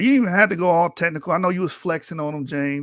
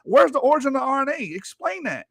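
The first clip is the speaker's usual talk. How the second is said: heated rant; 10 semitones higher, faster than usual. Real, not acted. Streamer ranting at the debate opponent, high heated demands. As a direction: demand an answer, getting worked up.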